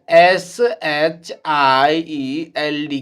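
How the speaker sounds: slow, deliberate letter-by-letter spelling for the class; teacher spelling a word slowly to students. Shown as a demonstration. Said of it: "देखिए".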